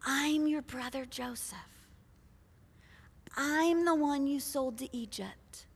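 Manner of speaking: quiet, weighty revelation; hushed reveal. Acted. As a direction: drop the voice for the big reveal. Dramatic reveal, music building.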